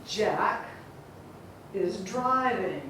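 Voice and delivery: "Jack is driving" slow, a long pause after "Jack"; spaced-out rhythm. Shown as a demonstration. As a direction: softly spell out the beats JACK, is DRI-ving. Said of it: "You hear that?".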